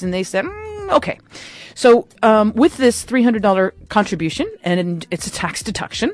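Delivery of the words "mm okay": mock hesitant, high-pitched hum of consent; reluctant consent. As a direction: act out hemming, then giving in. Acts out archives' reluctant consent.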